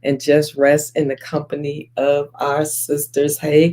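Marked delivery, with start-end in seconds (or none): none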